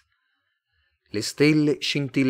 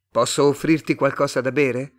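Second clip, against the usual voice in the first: hearty offer; 5 semitones higher, 7 dB louder than usual. A narrator's reading. Tomás offers the alien a drink.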